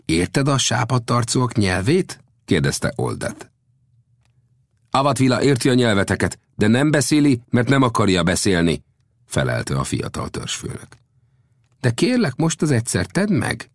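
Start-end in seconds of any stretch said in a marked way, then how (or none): 0.1-2.2 lightly voiced, high-pitched question; Old Death questioning. Old Death questions the chief, lightly voiced. 4.9-8.8 high-pitched, firm chief's voice; proud young chief. Acted. Young chief refuses to speak their language, high and firm. 11.8-13.7 high-pitched request; pleading. Acted. Old Death asks the chief for a favour, pleading.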